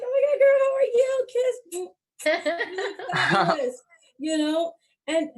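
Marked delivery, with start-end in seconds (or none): 0.0-3.8 high, loud, gushing fake-friend squeal; shrieking "girl, how are you" with a laugh. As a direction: overdo the delight, totally fake. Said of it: "I'm like".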